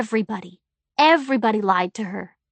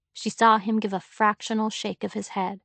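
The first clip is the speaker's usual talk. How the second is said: ordinary delivery, nothing marked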